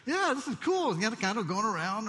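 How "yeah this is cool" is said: high, relaxed frog voice; a frog lazing in the warm pot; contented frog. Retold or imitated. Preacher playing the frog lounging in the pot, high and pleased.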